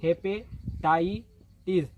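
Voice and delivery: Hepatitis drawn out syllable by syllable; hepatitis stretched out, each syllable clear; slow spelled-out word. Shown as a demonstration. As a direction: say hepatitis very slowly for students. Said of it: "अब इस H का मतलब hepatitis".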